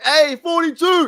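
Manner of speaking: high, loud, slow mock yell at a player; retold yell. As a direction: bellow a jersey number across the stands. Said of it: "he was like".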